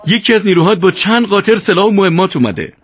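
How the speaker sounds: high, bright-voiced burst of good news; joyful news. A narrator's reading. Ezzati hugging Yousef, telling him gladly about the mules, overjoyed. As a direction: announce it overjoyed. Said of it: "با خوشحالی گفت".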